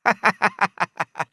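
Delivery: high bursting laugh of mockery; merry mocking guffaw; loud laugh. A narrator's reading. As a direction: roar with laughter at him. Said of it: "Залился Джингль".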